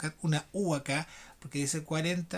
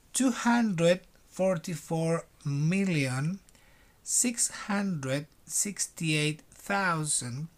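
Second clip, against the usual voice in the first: slow dictation reading; slower than usual. Shown as a demonstration. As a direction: read it out slowly for the class to repeat. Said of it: "Primero lo voy a leer lento".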